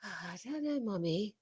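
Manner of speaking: put-on very soft, small child's voice; shy little girl murmuring; mimicking her little daughter, barely audible; timid child. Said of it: "She went".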